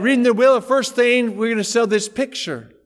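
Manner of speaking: loud, high public call; auctioneer announcing. Retold or imitated. Auctioneer announcing the first lot, loud proclaiming. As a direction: proclaim it to the whole room.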